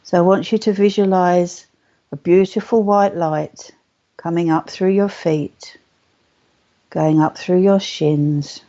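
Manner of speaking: unhurried, soothing guide, lingering on the key words; guided meditation. Acted. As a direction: lead them gently into calm, phrase by phrase.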